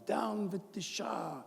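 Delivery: high, drawn-out mock protest chant; imitating screaming protesters. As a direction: put on a high-pitched protester voice, slowly. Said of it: "screaming at the top of their lungs".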